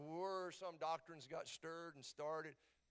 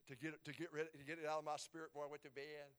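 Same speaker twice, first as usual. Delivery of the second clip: ordinary talk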